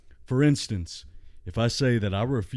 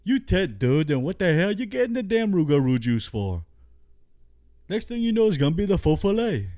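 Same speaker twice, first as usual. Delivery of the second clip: higher, exasperated Cajun rant; fed-up friend scolding in a raised voice; pitched-up Cajun character ranting; Boudreaux voice; 6 semitones higher than usual. Acted. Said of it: "Boudreaux looks at him".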